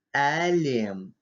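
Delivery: Элем opening on a soft, fronted British-style æ; soft front vowel. Shown as a demonstration. Teacher showing a soft letter, Элем with fronted æ. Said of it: "Мягкий звук".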